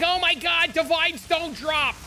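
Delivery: high, slow complaining player; mock whiny player. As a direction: whine it like a frustrated newbie. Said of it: "they're like".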